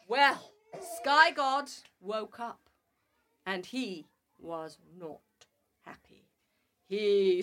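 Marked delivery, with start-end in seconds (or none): none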